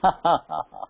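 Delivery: a short laugh, acting out the maid; imitated laugh. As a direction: laugh as the maid breaks into giggles. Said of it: "दासी हंसने लग गई". (दासी की नकल में छोटी सी हँसी)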